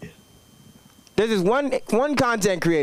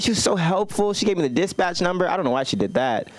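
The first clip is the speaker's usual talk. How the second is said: ordinary delivery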